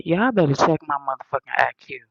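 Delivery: ordinary talk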